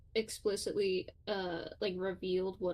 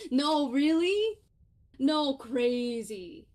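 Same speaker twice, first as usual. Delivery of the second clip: loud, slow, higher mock amazement; mock surprise; 5 semitones higher, 6 dB louder, much slower than usual. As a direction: play up fake shock. Mock amazement at own line.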